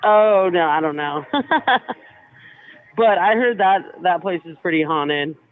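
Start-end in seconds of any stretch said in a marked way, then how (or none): none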